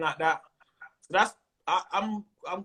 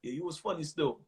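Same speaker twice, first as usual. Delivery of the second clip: low, reserved, no smile in it; unsmiling stush; 5 semitones lower, 7 dB quieter than usual. Acted. London punter praising comedian, unsmiling and stush. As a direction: act a cool Londoner, praise held right back. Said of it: "not smiling".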